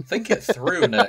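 high, laughing jab; mock-scold him while giggling; laughing tease. Real, not acted. Teasing Nick about horses up Big Ben, laughing.

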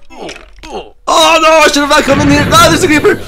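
high, loud frantic yelling; terrified gamer screaming at an ambush; panicked scream. Real, not acted. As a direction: shriek in real alarm. Panics on meeting a creeper.

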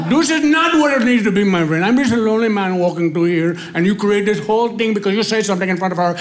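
high-pitched, indignant imitated Indian accent; upset Indian accent. Offended passer-by blames the dad, upset Indian accent. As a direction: act out an aggrieved Indian man. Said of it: "and he was upset".

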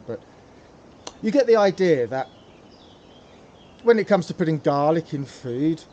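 ordinary talk

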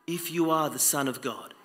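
the devil tempting Jesus, in a hushed, sly voice; hushed devil quote. Retold or imitated. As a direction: breathe the devil's taunt quietly. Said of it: "what did the devil say?".

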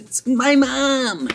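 very high, drawn-out cry; hammed-up big reveal; mock shocked reveal. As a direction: stretch it out in a high squeal. Reveals who is at the door.

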